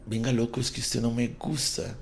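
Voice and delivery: a woman turns him down, hushed and dismissive; mock rejection. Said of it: "obligar a una mujer a que te diga".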